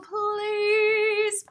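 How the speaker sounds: ordinary delivery, nothing marked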